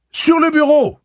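high, full-force yell; frantic high shout. A narrator's reading. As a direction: bellow an urgent instruction from across the room. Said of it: "cria Roland".

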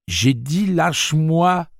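he wrenches free, voice pitched high; lâche-moi dragged out high and loud; angry protest. Acted. Jake fighting off his father.

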